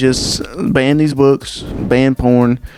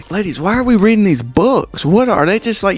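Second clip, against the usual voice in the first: higher, baffled appeal to the ladies; exasperated disbelief; 7 semitones higher than usual. Acted. Comic asking the women why they read these books, baffled. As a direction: throw the question out in disbelief.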